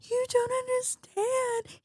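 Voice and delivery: high, slow tearful wail; imitating crying. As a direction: whine it through mock tears. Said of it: "And I'm like".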